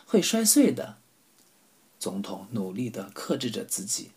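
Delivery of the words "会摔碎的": teasing. Acted. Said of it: "他笑着说".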